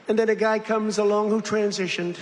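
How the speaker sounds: usual speaking voice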